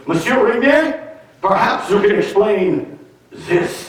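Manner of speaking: put-on high, slow French-accented inquiry; high, slow mock Clouseau voice; Inspector Clouseau. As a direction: ham up a Clouseau interrogation. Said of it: "Peter Sellers as Inspector Clouseau".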